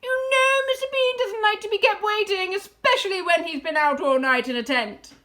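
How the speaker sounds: high, loud nagging call; woman calling out. A narrator's reading. Woman hurrying Mabel along, calling out. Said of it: "the voice called".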